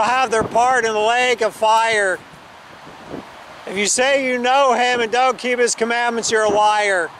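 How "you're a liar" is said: normal talking voice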